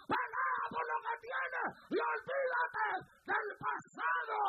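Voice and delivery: very loud, high preacher's shout; roaring pulpit exhortation; full-force preaching. Real, not acted. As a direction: hammer each phrase out, stretching the stressed words.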